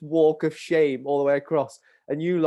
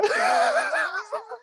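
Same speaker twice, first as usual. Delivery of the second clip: very high, squealing laugh; helpless laugh. Real, not acted. Storyteller laughing at mum on the sideline, helpless. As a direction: break up in high-pitched laughter.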